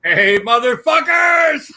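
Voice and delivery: rowdy shouted entrance. Pretend. Guest making his entrance to the hosts, rowdy holler. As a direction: burst in hollering to the crowd. Guest bursts in after introduction.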